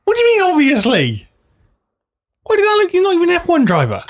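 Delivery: high-pitched, outraged questioning; indignant disbelief. Acted. Co-host objecting to a non-F one winner, incredulous. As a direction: protest in a squeaky, incredulous voice.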